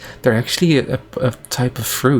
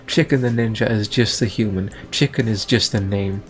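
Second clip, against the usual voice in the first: ordinary talk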